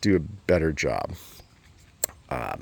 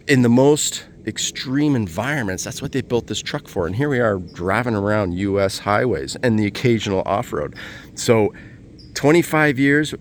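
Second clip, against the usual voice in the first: ordinary talk, nothing marked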